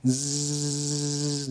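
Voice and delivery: soft imitated buzzing of mosquitoes; quiet mock mosquito drone. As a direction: buzz like a mosquito swarm. Mimicking mosquito swarm buzzing.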